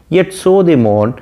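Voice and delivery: slow, measured recitation of the verse; reading the poem. Shown as a demonstration. Teacher reciting the sonnet line slowly to students. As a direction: read the line out slowly to the class.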